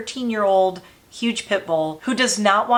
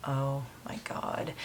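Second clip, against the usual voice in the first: slow, breathless whispered awe; awed whisper; 5 semitones lower, 13 dB quieter, slower than usual. Real, not acted. Seeing her late dog's photo, a slow awed whisper. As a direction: let it slip out under the breath. Moved by old dog photo.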